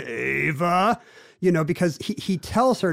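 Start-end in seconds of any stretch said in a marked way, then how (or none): 0.0-1.0 a lightly voiced slow-burn husband; slow burn Gleason. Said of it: "the slow burn kind of Jackie Gleason".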